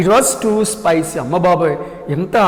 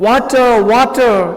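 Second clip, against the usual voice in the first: higher mock cry; crying for water; 6 semitones higher, 3 dB louder, much slower than usual. King burnt by spicy food cries for water, mock cry. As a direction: call out for water, mouth on fire. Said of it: "he cried like that".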